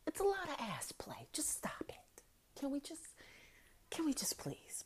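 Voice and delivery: whispered begging; hushed, half-laughing begging; mock whisper plea. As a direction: plead under your breath. Begging him to stop.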